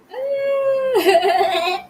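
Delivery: delighted high squeal breaking into laughter; squeal, then giggle; long high happy laugh; high giggly squeal. Real, not acted. Delighted after viewer's gift.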